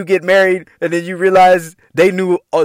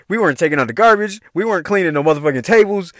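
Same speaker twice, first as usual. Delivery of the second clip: ordinary talk, nothing marked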